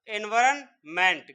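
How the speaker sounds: Environment said slowly, syllable by syllable; slow spelled-out word. Shown as a demonstration. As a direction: stretch environment out slowly, each syllable separate. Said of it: "क्या हो जाएगा फिर".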